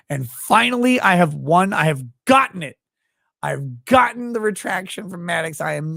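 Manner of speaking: gloating triumphant announcement; mock triumph. Real, not acted. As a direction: crow about winning. Mock triumph over retraction.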